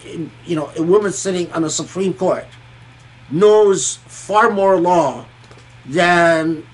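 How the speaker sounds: normal talking voice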